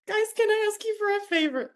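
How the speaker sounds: high-pitched plea to mentors; pleading request. Retold or imitated. Retold plea to her mentors.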